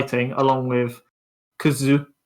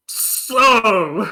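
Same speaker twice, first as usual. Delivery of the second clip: a confused exclamation pitched higher; mock old-timer misreading; 6 semitones higher, 4 dB louder than usual. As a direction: blurt it like someone who mistakes the letters for a word. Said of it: "So they see S O and go".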